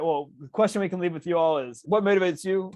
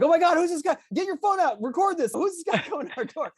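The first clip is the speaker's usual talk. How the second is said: high-pitched panicked couple, hammed up; mock freak-out; 13 semitones higher than usual. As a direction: retell it as a frantic, high panic. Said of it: "like, freaking out".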